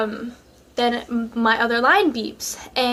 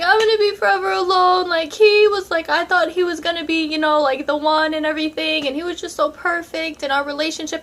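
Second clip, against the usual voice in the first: mock wailing; 5 semitones higher than usual. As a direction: wail it like a heartbroken drama queen. Crying on phone to friend.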